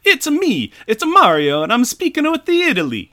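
high, loud Mario-style Italian accent; absurdly hammed-up Italian accent; generic mock-Italian accent. Shown as a demonstration. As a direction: belt it in a cartoon Italian accent. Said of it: "do you wanna hear my generic, uh, accent".

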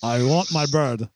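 slow, low mock Russian accent; bad Russian accent. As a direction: growl it in a forced Russian accent. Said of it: "his terrible Russian accent".

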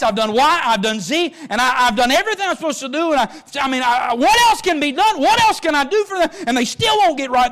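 a believer venting to the Lord that nothing works; frustrated shout. Acted.

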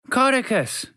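high shout for his photographer; shouting a name. A narrator's reading. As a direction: call him across the room. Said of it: "Geronimo Germson shouted".

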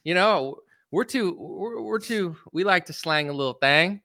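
badly attempted politician's Southern accent; halting, half-hearted go at a presidential accent; Bill Clinton accent. Joking about the politicians' meeting, a poor Clinton accent. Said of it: "the worst Bill Clinton attempt at an accent ever".

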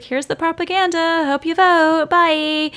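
high, airy dismissive voice mocking the ex; mock breezy ex-girlfriend. Podcaster mocking the ex-girlfriend's doorstep visit, high and breezy. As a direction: toss it off like a curt sales pitch.

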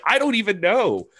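high, puzzled; a hint of a puzzled wrestler; baffled reply. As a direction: shrug it off confused. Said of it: "And she's like".

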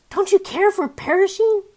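mock panicked plea. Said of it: "he might have said".

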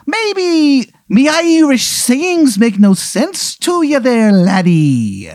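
high, slow overdone brogue; stage-Irish leprechaun drawl; bad Irish accent. Leprechaun ghost explaining to the laddie, bad Irish accent. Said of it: "His, his accent is terrible".

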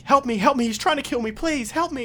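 high, loud frantic begging; desperate woman begging a stranger for help; terrified pleading. Acted. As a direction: plead for your life. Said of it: "her voice is not modulated".

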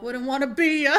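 sing-song, loud playful tease; loud teasing. Pretend. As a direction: belt out the rhyme as a tease. Rhyming comeback, much louder.

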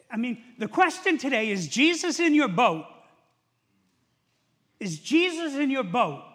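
loud, high challenge to the congregation; forceful preaching. Real, not acted. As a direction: drive the question home at full voice. Preacher presses the central question.

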